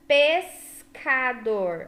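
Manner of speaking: "Pescador" stretched out very slowly, syllable by syllable. Shown as a demonstration. Teacher sounds out "Pescador" slowly for the children. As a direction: drag "Pescador" out for the kids to hear each part. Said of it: "você fala devagar".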